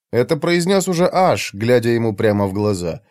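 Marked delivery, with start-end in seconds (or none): none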